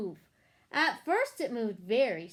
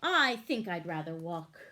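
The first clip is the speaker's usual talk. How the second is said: low horse voice; 7 semitones lower than usual. A narrator's reading. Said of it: "what he thought was".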